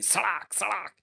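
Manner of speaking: high vocal lashes, one after another; whip crack sounds. Acted. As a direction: imitate repeated whip cracks, pitched high. Narrator voices repeated lashes. (कोड़े की ऊँची सड़ाक-सड़ाक)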